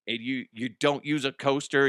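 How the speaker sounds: ordinary talk, nothing marked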